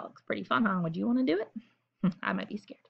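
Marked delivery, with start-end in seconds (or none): 2.2-2.8 soft unvoiced whisper; a reader whispering a small confession. Real, not acted. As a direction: confide it in a hush. Admits fear, whispering.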